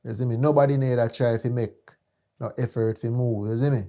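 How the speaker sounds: ordinary delivery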